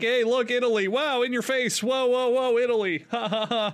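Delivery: over-the-top mock tour guide; cheesy theme-park host. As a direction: sell it like a fake Italian barker with a mock laugh. Mocking a Disney showman host.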